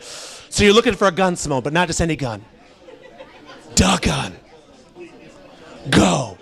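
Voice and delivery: low mock-grave casting director; all serious. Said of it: "he's, like, all serious".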